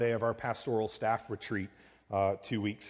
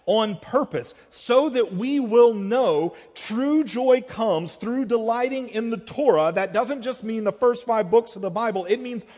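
loud, high, driving sermon delivery; impassioned preaching; 10 semitones higher, 9 dB louder than usual. Real, not acted. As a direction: preach it at full force, voice raised. Preaches at full force.

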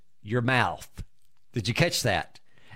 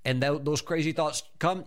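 normal talking voice

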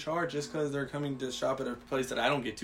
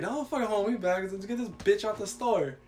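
retold angry protest; 7 semitones higher than usual. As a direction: act out struggling free, pitched up. Fighting off coworker holding him back.

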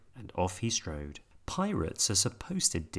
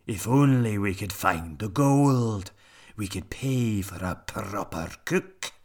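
slow, louder captain's sigh; pirate captain voice; 6 dB louder than usual. A narrator's reading. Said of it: "said Hank Chief".

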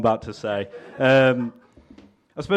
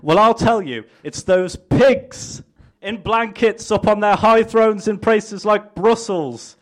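lightly raised, hammed-up politician's pitch; mock campaign speech; 8 semitones higher than usual. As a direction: sell it like a smug candidate at a rally.

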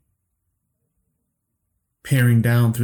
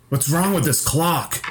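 raised, high-pitched cry of aggravation; exasperated shout; 7 semitones higher than usual. A narrator's reading. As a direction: cry out in aggravation, pitch up, without real volume. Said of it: "I shouted".

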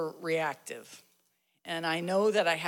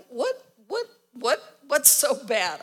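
alarmed husband; 6 semitones higher, 8 dB louder than usual. Retold or imitated. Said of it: "He's like".

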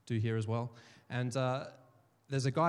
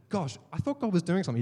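mildly annoyed; 5 semitones higher, 4 dB louder than usual. Retold or imitated. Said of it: "I was a little bit annoyed".

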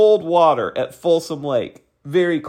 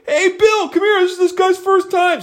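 high-pitched eager summons; hammed-up enthusiastic call, pitched way up; excited calling over. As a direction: imitate someone hailing a buddy with big news. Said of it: "lose their enthusiasm for me".

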